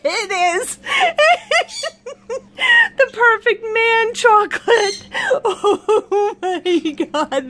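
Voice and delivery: high squeals of laughter between words; cracking up. Real, not acted. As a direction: talk through a real laughing fit. Cracking up at chocolate man.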